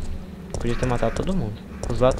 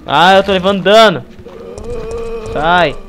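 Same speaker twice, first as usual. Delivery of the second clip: loud distressed; 6 semitones higher, 8 dB louder than usual. Real, not acted. Taking damage.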